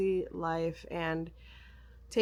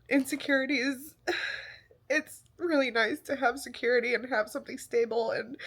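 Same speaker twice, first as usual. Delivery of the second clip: normal talking voice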